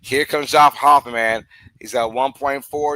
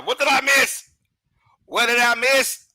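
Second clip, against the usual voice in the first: anxious question; 9 semitones higher than usual. Real, not acted. Stream returns, asks what missed.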